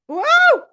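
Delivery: high, loud cry of alarm; startled yelp. Retold or imitated. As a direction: yelp as the chase takes off. Running after cats and mouse.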